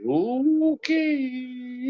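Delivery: high, long drawn-out okay; taken-aback okay. Retold or imitated. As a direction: stretch it out, high and wary. Stunned reaction to the outburst.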